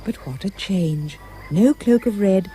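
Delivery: usual speaking voice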